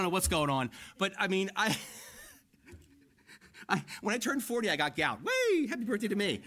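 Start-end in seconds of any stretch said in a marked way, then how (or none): 5.2-6.4 high sarcastic whoop; sarcastic yelp of joy; mock cheer. Real, not acted. Pastor mocking his own birthday with a whoop.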